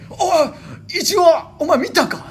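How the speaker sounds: high, loud mock shout of alarm; でっかい声の叫び真似. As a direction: shout it like startled cousin calling out across a train. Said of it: "っていうでっかい声で". (興奮した大声で叫ぶ真似)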